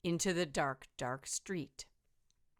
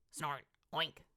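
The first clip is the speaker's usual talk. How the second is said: ordinary delivery, nothing marked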